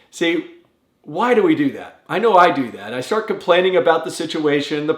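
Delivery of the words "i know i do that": ordinary talk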